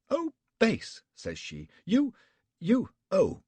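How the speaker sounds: high, loud, breathless indignation; sputtering outrage. A narrator's reading. Betty splutters at Flashman, high and indignant. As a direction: choke on the words in fury.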